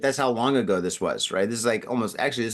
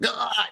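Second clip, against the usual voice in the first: high, brief mock cry of shock; jolted cry; 8 semitones higher than usual. Man reenacting a jolt through his body, yelping. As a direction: retell it like a finger in a socket. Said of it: "this electric jolt that went through".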